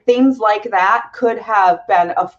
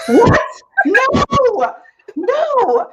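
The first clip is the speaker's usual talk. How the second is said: shocked outcry; 12 semitones higher, 3 dB louder, much slower than usual. Retold or imitated. Shocked reaction retold.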